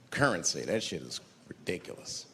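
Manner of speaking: ordinary delivery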